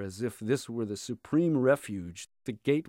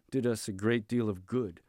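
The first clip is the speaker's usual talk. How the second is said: ordinary delivery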